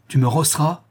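incredulous, heated retort; furious challenge. A narrator's reading. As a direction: challenge him in outrage. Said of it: "s'écria le bandit furieux".

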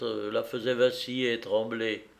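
ordinary delivery

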